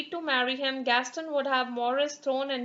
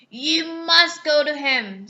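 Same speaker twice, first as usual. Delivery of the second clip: beast releasing; 7 dB louder, slower than usual. Acted. Beast lets Belle go.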